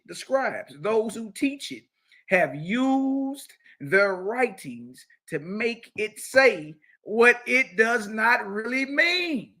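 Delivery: ordinary talk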